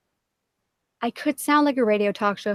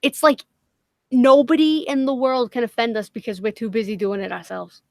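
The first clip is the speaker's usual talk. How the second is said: ordinary talk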